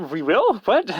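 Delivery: baffled question, pitch jumping up high; retold bewildered double take. Puzzled reply to odd farewell.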